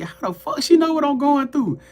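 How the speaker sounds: high-pitched, incredulous self-questioning; retold crashing out. Stunned by a tarot reader's accuracy, retold high disbelief. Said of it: "And I'm crashing out".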